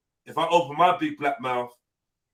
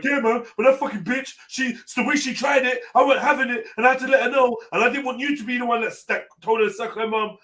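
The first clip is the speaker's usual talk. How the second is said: mock heated rant, higher pitched; heated self-justifying; 7 semitones higher than usual. Woman defending her outburst to her man, heated self-justifying. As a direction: imitate someone hotly justifying themselves, pitched up. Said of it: "you're gonna be like".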